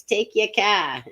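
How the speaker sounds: mock Massachusetts accent, dropping the r in "car". Shown as a demonstration. Host attempting the Northeast, Massachusetts accent. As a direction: have a rough go at a Massachusetts accent. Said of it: "like the Massachusetts".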